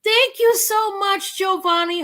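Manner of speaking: high, tearful thank-you; choked-up thanks. Real, not acted. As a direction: thank him while fighting tears. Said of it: "I'm not gonna cry".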